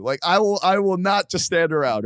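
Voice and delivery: high, insistent warning; heated threat. Retold or imitated. Warning a stalker not to come over, heated threat. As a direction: lay down the threat forcefully.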